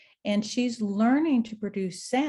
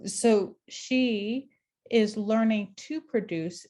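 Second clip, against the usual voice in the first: ordinary talk, nothing marked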